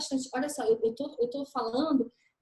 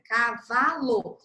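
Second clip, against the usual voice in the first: "Cavalo" slowly split into ca, va, lo; sílabas de "Cavalo" separadas; slow syllables; 5 dB louder, much slower than usual. Shown as a demonstration. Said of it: "da esquerda aqui pra direita".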